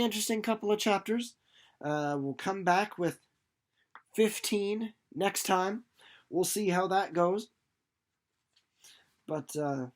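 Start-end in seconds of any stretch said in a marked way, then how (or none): 4.1-5.8 slow stretched outro tease; drawn out. Real, not acted. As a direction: stretch fifteen out. Drawn-out sign-off.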